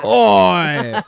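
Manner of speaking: high, drawn-out bellow; pirate crewman's shout. Acted. Another pirate joining the morale chant, shouted. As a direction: holler it across the deck. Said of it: "another guy would go".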